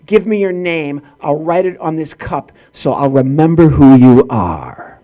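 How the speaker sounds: imitated clerk building to a loud, low, drawn-out roar; hammed-up furious clerk; mock angry yell. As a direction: start firm, end in a slow monstrous bellow. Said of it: "then she yelled at him".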